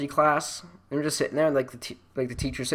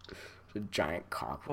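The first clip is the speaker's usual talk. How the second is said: ordinary talk, nothing marked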